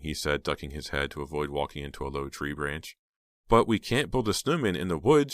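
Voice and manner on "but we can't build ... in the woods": protesting. A narrator's reading. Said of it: "she protested".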